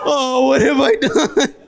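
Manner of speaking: slow, high, laughing mock despair; slow, high wail of mock remorse; drawn-out mock horror. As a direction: stretch out the fake guilt, laughing.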